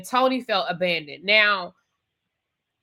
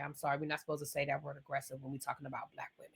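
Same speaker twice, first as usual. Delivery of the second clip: quiet sarcastic aside; 7 semitones lower, 18 dB quieter, faster than usual. Real, not acted. Sarcastic aside about word choice.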